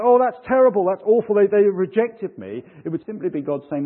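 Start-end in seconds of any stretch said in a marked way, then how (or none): 0.0-2.6 high, loud wail of complaint, acted out; hammed-up "woe is me" outburst, pitched up; mock upset. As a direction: play someone dismayed at being rejected. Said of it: "wouldn't have been upset".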